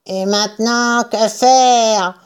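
slightly put-on character question; lightly voiced farmer. A narrator's reading. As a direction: ask in the dumpy farmer's voice. Buns demande, voix de personnage. (petite voix de personnage)

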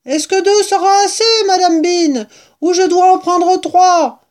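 high, loud holler; shouting up. A narrator's reading. Woman asks mistress how many jars, shouting. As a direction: bellow the question up the stairs.

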